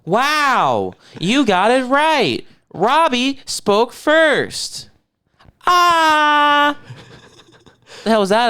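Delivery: high, loud, slow mock cheering; mock game show host. Mocking his co-host's slip, hammed-up game show host. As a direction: ham up a quiz host's delight.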